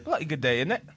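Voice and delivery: playful attempted British; British accent. Shown as a demonstration. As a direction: toss in a put-on British tag question. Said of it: "we're gonna hear Eli's British accent after".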